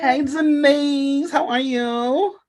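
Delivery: high sing-song greeting. Acted. Playful high greeting back.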